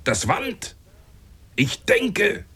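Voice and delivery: high, urgent shouts to the captain; shouted. Acted. Baker yelling to the captain to cut the masts, high shouts. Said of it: "und schrie".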